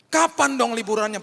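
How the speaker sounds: put-on child pleading. Daughter nagging her dad about the holiday, high and pleading. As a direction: nag like a disappointed kid.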